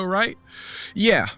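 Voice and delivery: ordinary talk, nothing marked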